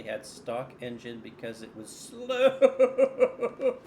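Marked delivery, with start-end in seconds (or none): 1.9-3.9 drawn-out word dissolving into laughter; amused laughing. Real, not acted. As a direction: stretch the word into a loud laugh. Mocking a slow rival car.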